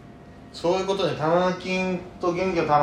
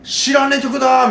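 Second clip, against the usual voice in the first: high, loud surprised exclaim; excited surprise; 13 semitones higher, 9 dB louder than usual. Retold or imitated. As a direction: blurt it out, startled and amused. Random karaoke number got in.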